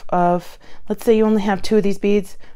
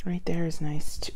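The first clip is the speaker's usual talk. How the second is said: whispering; 5 dB quieter than usual. Real, not acted. Whisper to self.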